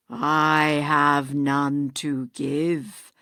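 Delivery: low, slow, eerie ghostly voice; hollow ghost. Acted. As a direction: intone like a dead man's spirit.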